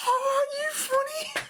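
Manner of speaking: very high, drawn-out mock voice; mock gushing reply. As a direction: put on a squeaky, slow retort. Retold reply to the women.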